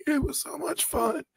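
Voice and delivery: imitating a hoarse, strained voice; high, thin hoarse rasp; raspy lost voice. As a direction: rasp it out like a voice shot from yelling. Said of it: "just raspy".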